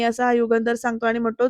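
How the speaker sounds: usual speaking voice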